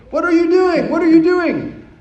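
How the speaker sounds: loud, high-pitched accusing shouts; outraged yelling. Retold or imitated. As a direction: imitate an angry crowd yelling at you. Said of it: "like, literal yelling at me".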